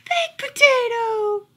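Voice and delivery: very high, slow sing-song call; a lady calling her pet through the rain. Acted. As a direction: call the lost dog home from the porch. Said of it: "hear her calling".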